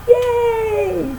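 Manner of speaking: high cheerful yay. Real, not acted. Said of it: "cheering the chapter".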